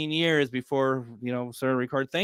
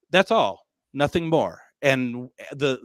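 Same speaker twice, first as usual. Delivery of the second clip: ordinary talk, nothing marked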